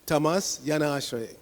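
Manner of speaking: "Tamas Yanashraye" with y in front of the a; "Yanashraye" led by a y glide; sandhi joining. Shown as a demonstration. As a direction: cut "Tamas" after s, begin "Yanashraye" on y. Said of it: "put the Y in front of the A".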